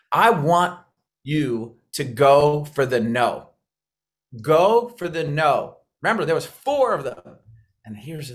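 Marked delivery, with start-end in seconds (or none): 1.9-5.8 slow, weighty push on GO; emphatic slogan. Real, not acted. Coach urging trainees to seek rejection, slow emphatic stress on GO. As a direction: hammer GO, drawing each word out.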